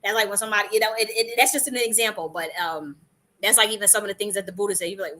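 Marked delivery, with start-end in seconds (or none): none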